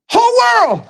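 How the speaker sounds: "whole world" leaps way up in pitch; soaring preacher emphasis on "whole"; preaching peak. Real, not acted. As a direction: lift "whole" high like a cry.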